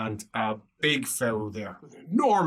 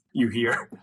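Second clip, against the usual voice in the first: ordinary talk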